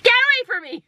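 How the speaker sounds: high-pitched snapping yell; mock furious high yell; retold angry outburst. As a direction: reenact lashing out, voice jumping way up. Acts out her angry outburst.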